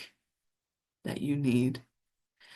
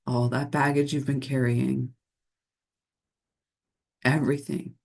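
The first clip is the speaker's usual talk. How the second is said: guided meditation. Acted. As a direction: lull listeners into the unseen realm of nature. Continues the guided message.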